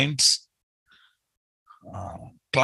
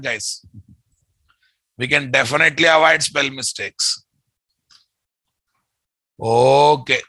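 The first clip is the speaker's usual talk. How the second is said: ordinary talk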